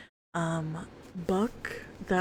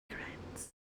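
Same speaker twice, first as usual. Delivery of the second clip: echoing whisper; 15 dB quieter than usual. Acted.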